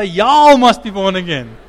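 loud, slow preacher's call; preachy brother. Pretend. Preacher echoing his own line to the congregation, loud and slow. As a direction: boom it out like a revival preacher. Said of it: "Amen, preachy brother".

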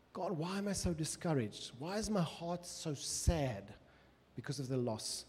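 soft, grieving plea to God; hushed lament; sorrowful prayer. Acted. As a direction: pray it softly as if heartbroken. Praying the lament psalm.

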